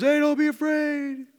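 high, slow, drawn-out call; calling from afar. Acted. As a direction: call out long across the storm. Jesus calling across the storm.

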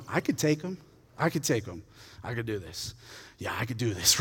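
low, soft, growing resolve; softly acted private boast; quiet self-convincing. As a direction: talk yourself into it under your breath. David muttering confidence to himself.

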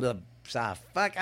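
ordinary delivery

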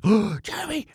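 high, alarmed gasp of a name; freaking out. Retold or imitated. Mom sees toddler alone on reversing tractor, imitated panicked cry. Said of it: "She's freaking out".

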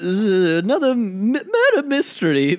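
stammering British gent; put-on British actor plugging his film; Kenneth Branagh. Caller promotes his next film, stammering British gent. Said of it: "a wimpy little British gent".

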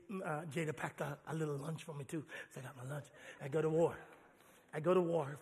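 ordinary talk, nothing marked

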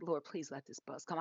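low, soft plea to the Lord; hushed prayer. Retold or imitated. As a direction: pray it quietly to yourself. Silent plea to God, retold.

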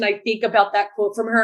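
usual speaking voice

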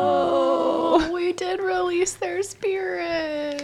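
choked up. Real, not acted. Emotional reaction.

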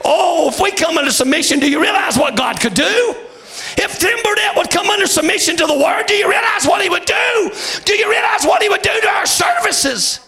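high, shouted hellfire sermon; full-force preaching. Acted. Preacher rouses the congregation about miracles, shouting. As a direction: preach it hard to the rafters.

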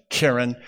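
keren said KEH-ren, weight on KEH; stress on first syllable. Shown as a demonstration. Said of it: "have the stress on the first syllable".

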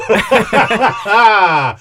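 big hearty laugh; loud laugh. Real, not acted. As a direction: burst out laughing. Host laughs at shy guest.

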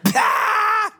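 sudden loud high laughing blurt; explosive mock belly laugh; kid's burst of laughter. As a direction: imitate a kid cracking up. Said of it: "He's like".

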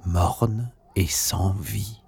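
plain reading voice

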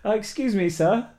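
sweet politeness before the blow; mock polite. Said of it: "phone voice".

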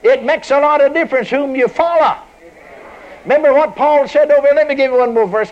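loud, high fervent preaching. Acted. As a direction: preach it out with full fire. Preaching at full force.

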